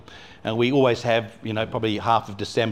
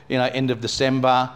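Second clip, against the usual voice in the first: usual speaking voice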